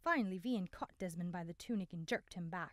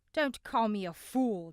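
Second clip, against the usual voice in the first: slow, loud angry growl; 3 semitones higher, 11 dB louder than usual. A narrator's reading. Desmond growls at Cale for calling him a fool, loud and slow. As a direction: lean into each word like a threat. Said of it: "Desmond growled".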